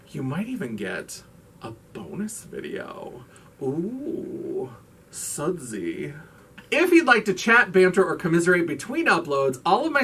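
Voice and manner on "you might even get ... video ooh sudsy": whispered, softer playful tease; teasing whisper. Pretend. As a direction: whisper it like a juicy secret. Teasing bonus video tease.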